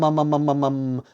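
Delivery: ordinary delivery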